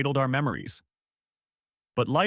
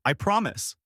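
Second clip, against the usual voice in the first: high-pitched vow; restrained shouted pledge; mild shout; 9 semitones higher than usual. A narrator's reading. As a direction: promise emphatically, pitch jumping up. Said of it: "he shouted".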